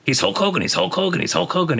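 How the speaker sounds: high, insistent mock accusation; frantic boss. Boss keeps accusing the wrestler, high mock frantic. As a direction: hammer out the repeats like a frustrated boss. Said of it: "And he goes".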